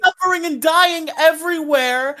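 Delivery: hammed-up angry atheist rant; mock edgy rant.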